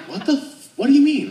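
high startled squeak of disbelief; shocked friend. Retold or imitated. Friend hearing it about his dad, a high shocked yelp. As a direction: friend caught off guard, yelping up high.

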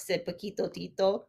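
plain reading voice

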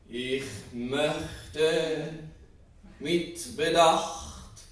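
very slow, drawn-out, sustained syllables; measured, ultra-slow delivery with long held vowels; deliberate and slow. Acted. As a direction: stretch each word out with great care. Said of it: "und langsam".

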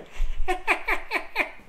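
very high, chattering squirrel laugh; tiny rapid squirrel giggle. Acted. Reader showing how a squirrel would laugh, high chattering laugh. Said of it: "that's how a squirrel would laugh".

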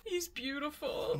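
soft, choked with tears; tearful whisper. Real, not acted. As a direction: murmur it through tears. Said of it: "do I have mascara running down my face".

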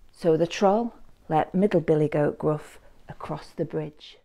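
ordinary delivery, nothing marked

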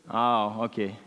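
ordinary talk

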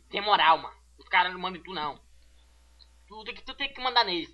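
ordinary talk

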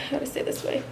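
breathless and whispery; winded, barely voiced; out of breath. Real, not acted. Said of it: "I'm out of breath".

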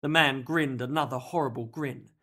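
ordinary delivery, nothing marked

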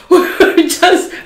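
slow, high, laughing words; losing it while telling. Real, not acted. Telling a fart story to viewers, breaking up laughing. Giggling fit at the memory.

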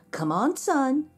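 high, coaxing call; urging. A narrator's reading. Mama donkey rousing her son for work, urging. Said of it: "Mama Donkey urged".

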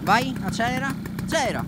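loud, high cheering him on; excited urging. Real, not acted. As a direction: egg the rider on loudly. Urging rider on.

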